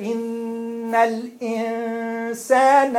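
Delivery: slow chanted recitation; Quran recitation. Shown as a demonstration. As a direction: recite it slowly and clearly. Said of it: "then connect them".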